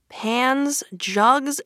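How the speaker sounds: ordinary delivery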